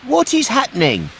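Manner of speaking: put-on high, urgent boy's voice; alarmed puppet boy. Pinocchio asks an old woman on the beach, high and alarmed. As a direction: ask urgently like a frightened child. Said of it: "Pinocchio asked".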